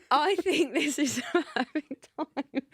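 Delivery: high, slowed words dissolving into giggles; helpless giggly delivery; laughing through words. Real, not acted. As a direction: try to present while cracking up. Retrying link while giggling.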